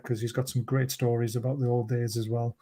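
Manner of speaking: usual speaking voice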